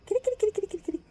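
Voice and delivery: high sing-song call to a kitty; calling the cat. Real, not acted. Calling her cat again.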